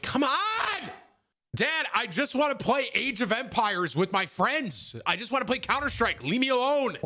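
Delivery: high-pitched pleading whine; hammed-up protest of a boy at his dad; imitated whining kid. Young gamer begging dad to let him play, mock whine. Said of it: "that was me growing up".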